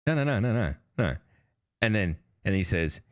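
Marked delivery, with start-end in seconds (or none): none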